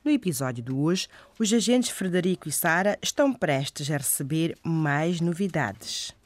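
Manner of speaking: ordinary talk, nothing marked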